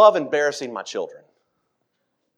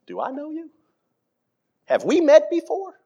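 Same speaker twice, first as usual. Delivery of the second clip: higher, puzzled "do I know you"; baffled question; 12 semitones higher than usual. Acted. Nathanael baffled by Jesus's greeting, higher and puzzled. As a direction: ask it as if caught off guard.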